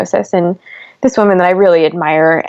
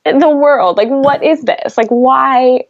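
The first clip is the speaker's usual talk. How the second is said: raised, slower, exasperated plea to God; a grieving mother crying out why to God, raised and exasperated; mock angry prayer; 5 semitones higher, slower than usual. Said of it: "they were like".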